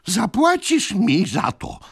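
high, slow, loud gasping threat; breathless threat. Acted. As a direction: threaten while fighting for breath. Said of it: "oddychając z trudnością". (wysoka, zdyszana groźba)

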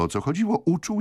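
ordinary delivery, nothing marked

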